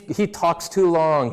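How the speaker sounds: normal talking voice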